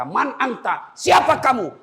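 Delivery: high voice rising to a shout; alarmed challenge. Acted. Corpse to the tormentor in the grave, alarmed challenge. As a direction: demand to know who is there.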